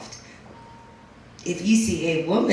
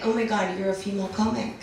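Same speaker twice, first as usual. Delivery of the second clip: softer, mock-admiring gush; 3 semitones lower, 12 dB quieter than usual. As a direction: gush softly as if praising heroics. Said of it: "people always say things like".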